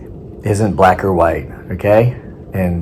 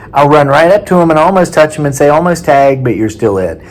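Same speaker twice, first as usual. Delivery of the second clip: playful taunt; 7 semitones higher, 5 dB louder than usual. Acted.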